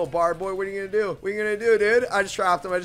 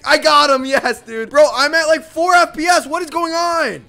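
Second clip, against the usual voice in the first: loud hyped yelling, much higher; hyped gamer bro; 12 semitones higher, 14 dB louder than usual. Real, not acted. Laggy fight, kill lands.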